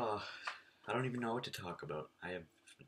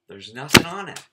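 excited; 7 semitones higher, 20 dB louder than usual. Real, not acted. Shows blank card to camera.

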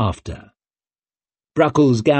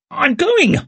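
high-pitched indignant squawk; squawked protest. A narrator's reading. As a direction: squawk it out helplessly. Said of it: "squawked Leo".

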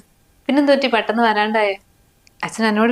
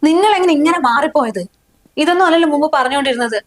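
higher-pitched, hurt protest; upset confrontation; 5 semitones higher, 3 dB louder than usual. Acted. Wife asking husband how he changed so much, upset. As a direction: challenge him, voice raised in pitch.

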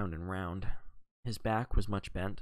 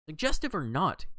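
cutting in, higher pitched; interrupting; 6 semitones higher, 4 dB louder than usual. A narrator's reading. John interrupts Wells's reasoning, cutting in. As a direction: break in on the other speaker firmly. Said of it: "interrupted John".

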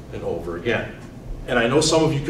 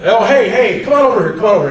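put-on higher, louder eager old man calling; beckoning grandson over, higher and louder; eager grandpa; 6 semitones higher, 6 dB louder, faster than usual. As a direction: wave him over like a proud grandpa. Grandfather greeting, voiced.

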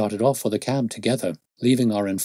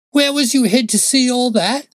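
loud, high, suspicious outburst; alarmed cry; 9 dB louder than usual. Acted. Frightened constable challenges the detective, loud high alarmed cry. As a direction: cry out in startled suspicion, voice shooting up. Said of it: "he cried".

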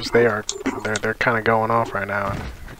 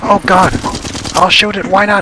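high, loud panic; panicked; 8 semitones higher, 7 dB louder than usual. Real, not acted. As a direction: scream for help. Panicked in a firefight.

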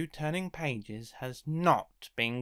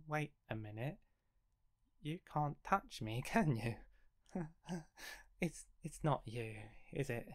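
much softer, dawning dread; fearful hush; 10 dB quieter than usual. Acted. Character softly realising something else is touching him.

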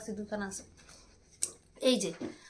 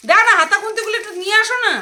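loud nagging; 8 semitones higher, 12 dB louder, faster than usual. Real, not acted. Nagging husband to fetch.

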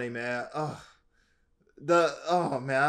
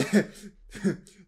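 burst of laughter; 5 semitones higher than usual. Real, not acted. Laughs at clip.

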